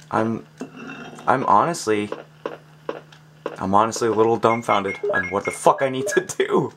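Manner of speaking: ordinary delivery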